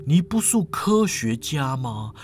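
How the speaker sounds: low, slow character voice; low-pitched, doubtful professor; sceptical professor. Acted.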